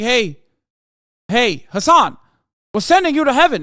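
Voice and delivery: high, loud announcing voice of God; God calling down. Pretend. God summons the streamer up to heaven, hammed-up divine call. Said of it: "God could be like".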